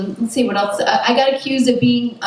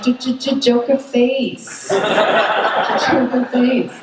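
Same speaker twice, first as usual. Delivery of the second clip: slow, drawn-out stammer of joker face; stuttering Joker voice; 3 semitones higher, much slower than usual. Retold or imitated. Tipsy wine taster mugging, very slow and stuttering. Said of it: "And you're like".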